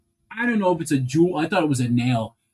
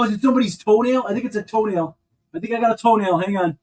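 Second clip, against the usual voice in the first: usual speaking voice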